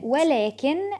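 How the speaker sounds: normal talking voice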